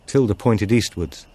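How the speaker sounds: usual speaking voice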